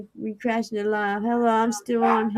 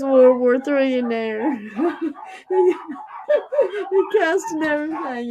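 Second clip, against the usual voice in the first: laughing; 5 semitones higher than usual. Real, not acted. Laughing about dogs fighting.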